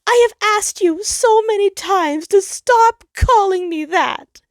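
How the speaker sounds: high, loud tearful plea; the sun crying at Jupiter, high and loud. Acted. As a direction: plead through the tears. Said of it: "he cried".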